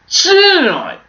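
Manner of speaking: high, loud, drawn-out TV presenter; Jeremy Clarkson. Shown as a demonstration. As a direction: hang on the word like a bombastic presenter. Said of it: "supposed to be Jeremy Clarkson".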